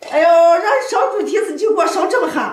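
loud, high exasperated complaint; scolding shout. Real, not acted. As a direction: scold loudly over the burnt food. Scolding burnt pig trotters.

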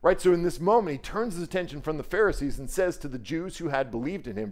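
ordinary delivery

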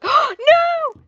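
high, loud gasp then no; shrieking at a sudden scare; startled scream. Real, not acted. Monster jump scare.

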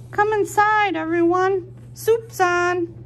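high and loud cheerful invitation; welcoming pig voice. Acted. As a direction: call everyone in to eat.

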